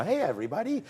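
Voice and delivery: put-on high sing-song greeting to little kids; kindergarten teacher. Shown as a demonstration. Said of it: "sound like I'm the kindergarten teacher".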